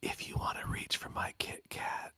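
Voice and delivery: breathy husky whisper; whispered mocking mimicry. Shown as a demonstration. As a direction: whisper it like a seductive creep. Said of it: "you gotta say it like this".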